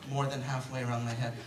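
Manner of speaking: ordinary talk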